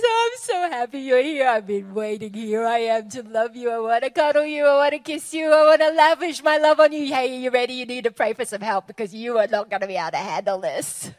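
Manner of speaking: joyful God voice, loud by the end; God's eager welcome. Retold or imitated. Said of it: "and he says".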